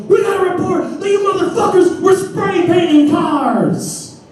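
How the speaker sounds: high hammed-up police officer; mock cop voice. Officer accuses the boys of spray painting, high mock cop voice. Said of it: "And he goes".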